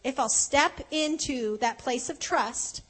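ordinary talk, nothing marked

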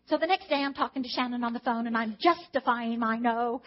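ordinary delivery

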